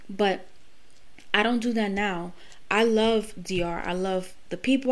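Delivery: ordinary talk, nothing marked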